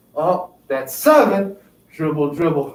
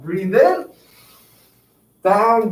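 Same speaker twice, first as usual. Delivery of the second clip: usual speaking voice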